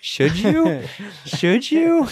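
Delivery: high questioning, climbing on the repeat; mock doubt. Podcaster mocking the scientist's Nobel Prize boast, sceptical.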